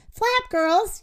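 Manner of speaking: high, urgent call to the flock; rooster's yell. A narrator's reading. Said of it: "yelled the".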